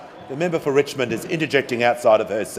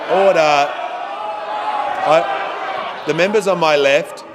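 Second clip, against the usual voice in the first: shouting order order; 4 semitones higher than usual. Real, not acted. Speaker calling House to order.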